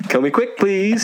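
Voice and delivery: high-pitched, joking little plea; playful high begging; mock plea.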